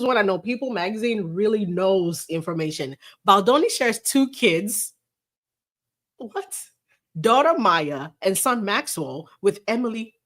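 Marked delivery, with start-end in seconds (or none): 6.2-6.6 mock shock. Real, not acted. Reacting to the article's trivial news, mock shock. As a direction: pretend to be stunned.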